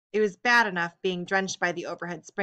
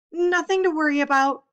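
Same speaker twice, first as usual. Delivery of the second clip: hoarse; 9 semitones higher, 8 dB louder than usual. A narrator's reading. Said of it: "she repeated hoarsely".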